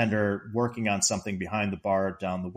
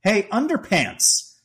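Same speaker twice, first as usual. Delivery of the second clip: slow, high mock holler; Clark yelling; 9 semitones higher, 5 dB louder, slower than usual. Retelling Clark insulting the bartender, mock yelling. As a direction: taunt someone down the bar, raised voice. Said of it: "yelling from down the bar".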